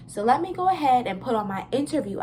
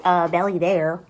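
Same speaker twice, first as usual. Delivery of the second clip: mock gawking; 2 semitones lower, 8 dB louder than usual. Mocking viewers noticing her belly.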